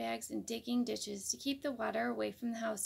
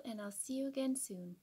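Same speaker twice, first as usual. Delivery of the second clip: ordinary delivery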